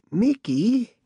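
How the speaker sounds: put-on eerie old woman voice. A narrator's reading. Bat Lady's ghostly call.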